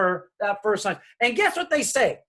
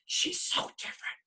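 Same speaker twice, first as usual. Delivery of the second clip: whisper; 10 dB quieter than usual. Retold or imitated. Parents say it in Spanish.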